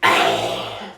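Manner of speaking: long noisy sniff through congestion; snot sniff. Real, not acted. Host with allergies pausing mid-episode, congested sniff. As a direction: suck the snot back up. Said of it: "when you suck your snot up".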